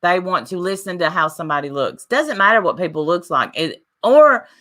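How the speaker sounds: ordinary talk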